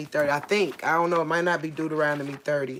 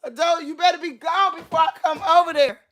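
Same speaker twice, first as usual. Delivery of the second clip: scared threat; 10 semitones higher, faster than usual. Real, not acted.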